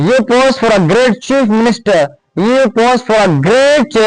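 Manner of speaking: very slow, drawn-out model sentences; slow dictation. Shown as a demonstration. Teacher giving examples to students, very slowly. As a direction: stretch each word for learners.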